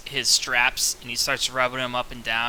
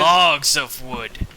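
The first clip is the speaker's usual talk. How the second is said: very slow, louder suggestive line; sly; 6 dB louder, much slower than usual. Acted. Gra courts the lady over her wood trade, sly. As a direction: savor each word as innuendo. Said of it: "he tries his best slyly".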